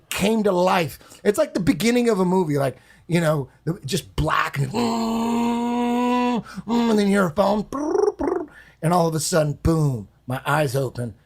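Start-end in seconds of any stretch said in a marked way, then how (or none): 4.7-6.4 high humming buzz imitating a vibrating phone; buzzing hum. 7.6-8.5 high brr imitating a ringing phone; phone ring noise.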